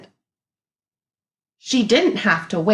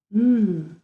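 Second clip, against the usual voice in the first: ordinary delivery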